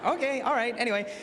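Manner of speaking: high, loud flustered brush-off; mock awkward teen; awkward self. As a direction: hurry past it, embarrassed. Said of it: "I was like".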